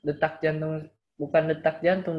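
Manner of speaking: usual speaking voice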